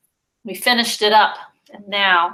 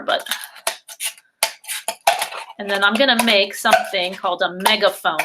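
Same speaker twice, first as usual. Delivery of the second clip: usual speaking voice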